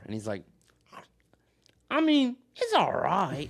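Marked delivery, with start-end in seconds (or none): 1.9-3.5 much higher, slow, drawn-out, louder mock voice; exaggerated impression of an unimpressed eater; imitating the meme guy. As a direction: ham up the grudging verdict. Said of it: "It's the meme where, he's just, like, in his car".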